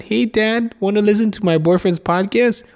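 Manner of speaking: put-on high, sweet, chirpy girlfriend; girlfriend's sweet voice. Girlfriend asking her dad to listen, chirpy and sweet. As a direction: sell the podcast to Dad brightly.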